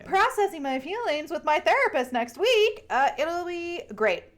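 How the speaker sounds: joking about therapy next week; sarcastic cheer. Real, not acted. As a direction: chirp it with irony.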